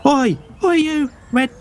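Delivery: put-on a small boy puffing himself up to fight; cheeky boy. Young boy shouting at strangers he thinks are bandits, very high and defiant. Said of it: "and he's like".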